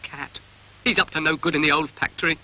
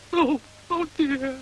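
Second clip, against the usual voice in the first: theatrical lament; 9 semitones higher, slower than usual. Acted. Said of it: "Very moving".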